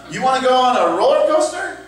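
high, rising play-acted invitation; eager offer. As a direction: offer it with put-on excitement. Acted second offer in improv example.

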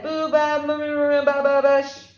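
put-on high, rising-loud baby babble. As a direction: babble nonsense like a toddler in his crib.

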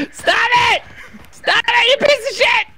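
high, exasperated yell; exasperated shout. Real, not acted. Player yelling at a fleeing food crate, exasperated shout. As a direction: yell for it to stop, pitch jumping up.

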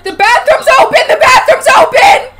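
high, loud repeated shriek of alarm; panicked shouting. Real, not acted. Alarm at opened bathroom door.

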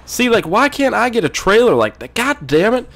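high, exasperated whine; mock-frustrated high pitch; put-on whiny voice. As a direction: complain in a high, swooping voice. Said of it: "I'm gonna start talking like that soon".

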